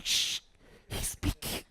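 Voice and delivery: urgent shush into a voiceless whisper; hushing whisper. Retold or imitated. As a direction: shush her without disturbing the speaker. Husband hushes wife during the preaching.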